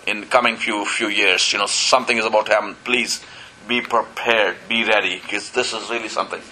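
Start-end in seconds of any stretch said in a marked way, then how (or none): none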